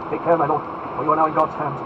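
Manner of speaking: forceful, earnest threat; solemn warning. Acted. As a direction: warn him with grave force. Felton warns the Duke.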